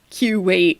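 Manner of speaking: Q wait as Kuwait split into KYOO-wait, first syllable stressed; mocking long Q in Q wait; misplaced stress. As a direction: imitate a learner saying KYOO-wait for Q wait. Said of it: "emphasis is always on the wrong syllable".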